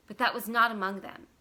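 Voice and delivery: usual speaking voice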